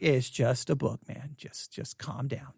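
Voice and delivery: flat, unimpressed brush-off; bored dismissal. Retold or imitated. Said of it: "people will be like".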